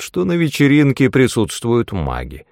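plain reading voice

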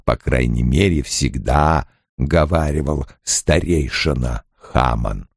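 very low, slow character voice; Loial, ponderous and patient. A narrator's reading. Loial explaining intrigue to his friend, slow and deep. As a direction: speak deep and unhurried, gently resigned.

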